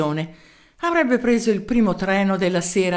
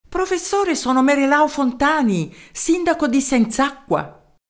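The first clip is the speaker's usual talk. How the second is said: a higher, eager mayor's voice; 7 semitones higher, 3 dB louder than usual. Acted. Mayor introducing himself to the professor, eager. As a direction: introduce yourself keenly down the phone.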